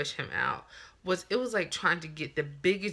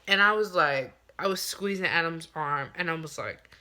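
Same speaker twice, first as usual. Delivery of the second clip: usual speaking voice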